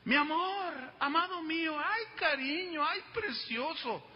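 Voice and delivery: lightly imitated doting lover calling out; affectionate lovers' voice. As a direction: ham up the gushing lover. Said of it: "ellos alzaban la voz".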